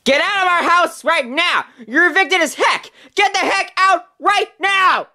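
high-pitched, furious ordering out; angry eviction. Acted. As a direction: throw them out with a high, indignant voice. Evicting the others in a quarrel.

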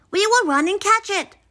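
rally them quickly in a high voice; urgent Frog. A narrator's reading. Frog offering to chase the list, urgent.

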